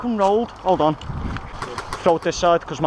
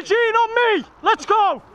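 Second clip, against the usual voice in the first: shout; 13 semitones higher than usual. Real, not acted. Rallying teammate under fire.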